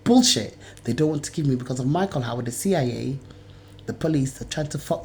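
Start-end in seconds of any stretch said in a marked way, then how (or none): 0.0-0.6 sharp, high, disgusted bullshit; scornful outburst. Real, not acted. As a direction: spit it out in contempt. Angry rant about documents.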